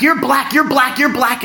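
high, insistent repeated accusation; imitating a woman's relentless scolding; mock berating. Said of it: "she was berating me".

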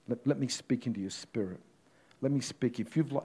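ordinary talk, nothing marked

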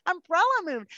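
high, pleading no to the umbrella; exasperated inner voice. Retold or imitated. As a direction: whine it out, begging for shade. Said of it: "And I'm like".

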